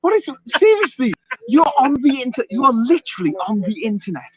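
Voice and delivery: exasperated rant. Real, not acted. Host scolding viewers paying for a nude dare, exasperated. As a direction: plead with them in rising disbelief. Mock outrage at viewers wanting nudity.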